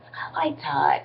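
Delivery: hushed, breathless confession; soft whisper to the camera; whispered aside. Real, not acted. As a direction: whisper it like a secret crush. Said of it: "whispered aside".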